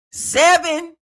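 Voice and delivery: high, loud disbelief; incredulous. Real, not acted. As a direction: blurt it out in shocked disbelief. Shocked at the age mentioned.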